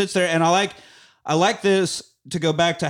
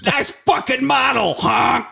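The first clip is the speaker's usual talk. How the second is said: high, loud mocking yell; shouting; 10 semitones higher, 4 dB louder, slower than usual. Real, not acted. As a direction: yell it out at the car. Shouting at passing car.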